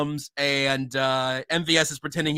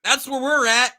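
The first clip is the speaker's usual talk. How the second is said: mock quote; 10 semitones higher, 3 dB louder than usual. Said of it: "as Charles Omenihu said".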